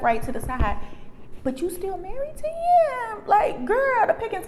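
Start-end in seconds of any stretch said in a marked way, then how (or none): 1.4-4.5 very high-pitched disbelieving question; incredulous. Acted. Friend challenging the woman still marrying him, incredulous squeal. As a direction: shoot the voice way up in disbelief.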